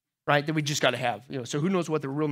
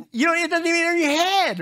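mock squeamish; 12 semitones higher, 6 dB louder than usual. Preacher voicing disgust, mock thoughts.

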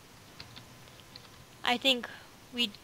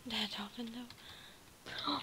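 whisper; 2 semitones lower, 17 dB quieter, slower than usual. Acted. Echoing in a whisper.

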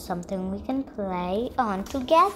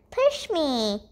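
usual speaking voice

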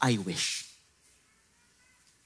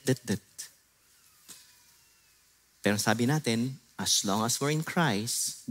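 soft, lower, reassuring voice of Jesus; gentle Jesus; 4 semitones lower, 3 dB quieter than usual. Acted. Jesus reassuring Peter his failure is not final, softly. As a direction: speak restoration gently.

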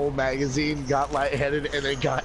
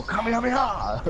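anime battle cry; 9 semitones higher than usual. Shown as a demonstration. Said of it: "you gotta go".

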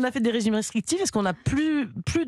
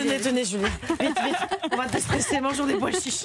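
laughing; 4 semitones higher than usual. Real, not acted. Joking about eating chickpeas.

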